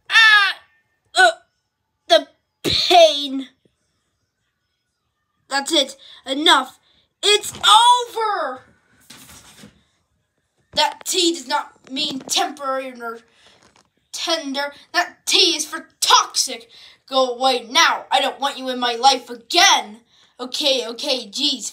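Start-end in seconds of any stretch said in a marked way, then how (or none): 0.1-3.5 pained cry. Acted. Hit by falling moon. 5.5-8.6 angry. Acted. Angry breakup. 14.9-20.0 angry. Acted. Angry breakup.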